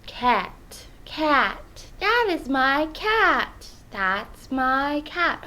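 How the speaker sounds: slow, drawn-out American "cat"; exaggerated American accent. Practising a hard word, overdone American accent. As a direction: drag out "cat" with a wide American vowel. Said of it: "in an American accent".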